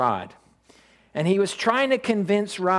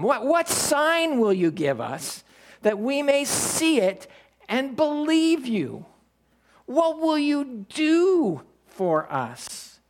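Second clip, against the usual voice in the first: crowd asking; 8 semitones higher, slower than usual. Retold or imitated. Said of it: "So they asked him".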